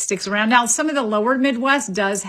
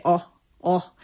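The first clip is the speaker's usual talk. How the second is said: "oh, oh." as a short pure Scandinavian vowel, no glide at the end; "oh, oh." kept as one pure vowel, Finnish or Swedish style. Shown as a demonstration. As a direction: clip "oh, oh." short and steady the Swedish way. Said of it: "if you're from, uh, Finland or Sweden, you say".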